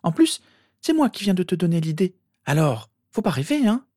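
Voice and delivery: put-on high girl's voice, knowing; dismissive sister. A narrator's reading. Sister seeing through her brother's prank, dismissive. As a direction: put him down in a high-pitched voice.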